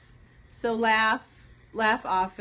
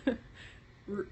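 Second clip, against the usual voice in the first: usual speaking voice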